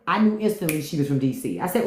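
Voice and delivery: usual speaking voice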